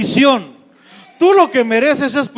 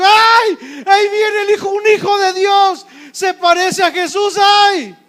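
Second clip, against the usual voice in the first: very high-pitched alarmed cry; hammed-up devil shrieking at the sight of believers; screaming devil; 10 semitones higher than usual. Devil seeing a son of God coming, screaming in alarm. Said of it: "El diablo es el que tiene que salir gritando".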